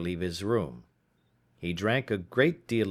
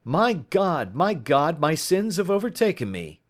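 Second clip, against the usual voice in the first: higher, louder wail of guilt and fear; panicked cry; 7 semitones higher, 4 dB louder than usual. Acted. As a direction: cry out as if doom has come. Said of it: "he shrieked".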